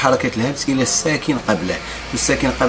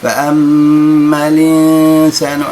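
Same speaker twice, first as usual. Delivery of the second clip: slow, melodic tajwid chanting; Quran recitation; 4 semitones higher, 7 dB louder, much slower than usual. Shown as a demonstration. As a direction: chant the verse slowly. Recites the verse. (ترتيل)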